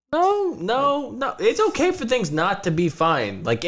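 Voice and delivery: ordinary talk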